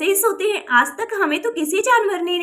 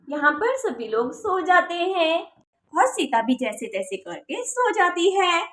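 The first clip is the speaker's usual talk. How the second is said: slow, hushed storyteller voice; slow quiet narration of a bedtime scene; story narration; 4 dB quieter than usual. A narrator's reading. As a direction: lull the scene to sleep, slowly.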